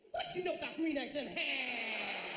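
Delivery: joyful drawn-out mimicry of a stranger; woman's exuberant holler. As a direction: belt it out slowly, stretching HAM. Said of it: "just by going like this".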